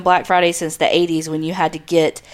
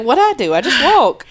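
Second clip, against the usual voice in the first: mock Christy; 6 semitones higher than usual. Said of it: "Christy's like".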